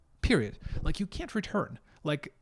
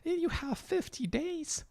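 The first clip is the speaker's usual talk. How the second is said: much higher, softer sweet saleswoman voice; lovely lady; 13 semitones higher, 6 dB quieter than usual. Retold or imitated. Canadian saleswoman tells customer about returns, sweet high voice. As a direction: coo it like a friendly shop clerk. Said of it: "this lovely lady said".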